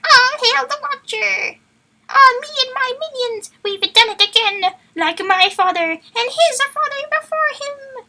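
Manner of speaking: high, loud, slow gloating villain; Watcher villain voice. Acted. Asher doing his proud villain speech, high gloating voice. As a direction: crow over your minions like a pompous stalker villain. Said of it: "Like that. That's his voice".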